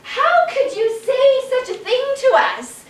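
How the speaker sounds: high, loud, indignant rant; furious mob. Acted. Angry mob ranting at the chicken, furious and loud. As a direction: rail at the chicken. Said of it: "Then they became furious".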